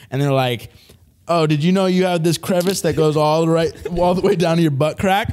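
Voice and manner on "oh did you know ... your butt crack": higher, hammed-up mock discovery; mock scrubber. Masseur to naked client about a crevice, mock discovery. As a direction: lightly voice a scrubber announcing a find. Voicing the scrubbers' commentary while retelling.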